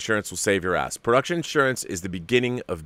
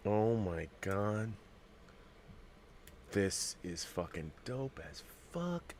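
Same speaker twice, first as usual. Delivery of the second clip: hushed awe; 17 dB quieter, slower than usual. Real, not acted. Awed at the announcement.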